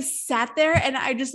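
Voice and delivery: ordinary delivery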